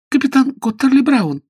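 high little squeak; rubbery high squeaky toy. A narrator's reading. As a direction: squeak it out timidly. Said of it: "пискнула Сью".

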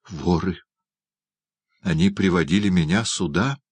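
plain reading voice

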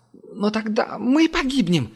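high, slow panicked cry; terrified wail. A narrator's reading. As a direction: plead in terror. Technician cries in terror.

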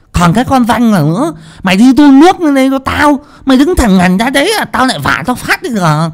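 high, loud scolding with a lisp; shrill-pitched scolding cook, fast. Acted. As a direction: berate the idle girl. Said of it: "ngọng líu ngọng lô".

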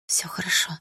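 soft unvoiced reassurance; whisper. Girl reassures her crying friend: soft whisper.

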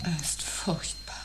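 soft, horrified whisper. Acted. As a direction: whisper it in dread. Reacting to the awful tone.

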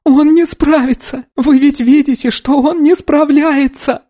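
high-pitched desperate wail; moaning. A narrator's reading. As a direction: plead despairingly in a high voice. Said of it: "простонала девушка".

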